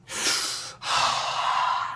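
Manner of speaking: long breathy puff; noisy smoker's exhale; mimed weed toke. Acted. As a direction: imitate inhaling and blowing out smoke. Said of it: "smoking weed or something like".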